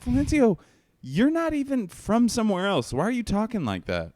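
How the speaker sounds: put-on high, loud incredulous kid; mock young self. Teen refusing the dealer, high incredulous squeak. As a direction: squeak it out in disbelief. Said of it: "And I was like".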